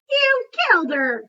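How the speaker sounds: complaining Bob. Acted. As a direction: whine it as the put-out dog. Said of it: "he complains".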